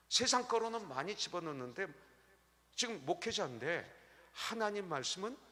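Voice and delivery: ordinary talk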